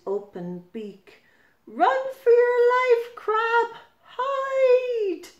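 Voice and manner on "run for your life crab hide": high, loud frantic shout; panicked warning cry. Acted. Narrator warns the crab of the gull, panicked. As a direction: scream at the crab to hide.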